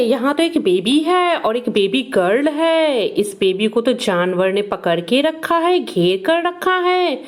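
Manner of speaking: ordinary talk, nothing marked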